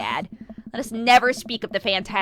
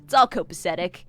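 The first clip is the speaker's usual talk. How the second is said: ordinary talk, nothing marked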